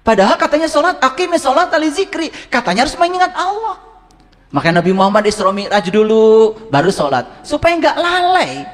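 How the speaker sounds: high-pitched fervent preaching. Acted. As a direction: press the congregation, urgent. Preaching on prayer.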